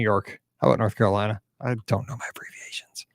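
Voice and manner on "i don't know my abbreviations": whispered aside. Real, not acted. Host muttering to himself over state names, whispered. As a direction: breathe it to yourself. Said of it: "whispered aside to self".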